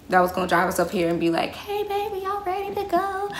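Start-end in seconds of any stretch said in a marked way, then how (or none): 1.5-3.3 high, soft sweet granny voice; sweet old lady. Pretend. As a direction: coo like a kind old lady picking you up. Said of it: "some sweet old lady".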